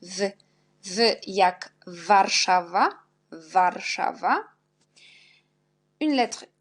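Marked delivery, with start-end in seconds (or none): 0.8-4.4 Warszawa said slowly, W as a clear V; teacher sounding out Warszawa with W as V; slow demo. Shown as a demonstration. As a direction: stretch out V jak Warszawa, Warszawa. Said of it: "On prononce V".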